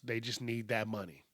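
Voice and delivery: ordinary talk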